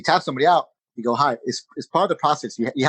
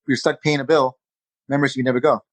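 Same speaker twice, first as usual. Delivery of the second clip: ordinary delivery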